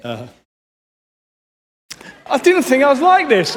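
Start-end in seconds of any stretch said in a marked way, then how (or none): 2.3-3.5 choked up and surprised; real tears breaking through; tearful break. Real, not acted. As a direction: voice cracking high with tears. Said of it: "We got a good old British crying".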